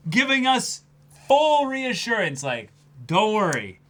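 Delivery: high, loud and slow reassurance; excited gushing. Real, not acted. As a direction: gush about the good news. Gushing paraphrase.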